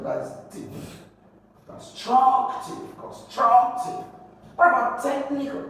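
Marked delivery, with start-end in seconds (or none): 1.7-4.0 constructive with STRUC high and drawn out; high, deliberate con-STRUC-tive; stress on second syllable. Shown as a demonstration. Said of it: "you stress the second syllable". 5.0-5.7 technical with TECH pitched high; stress on first syllable. Shown as a demonstration. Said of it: "Technical is the first syllable".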